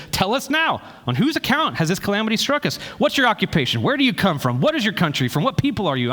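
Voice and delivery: high, frantic questions fired fast; panicked sailors. Retold or imitated. Frightened sailors pelting Jonah with questions, high and frantic. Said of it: "this is them just kind of flipping out".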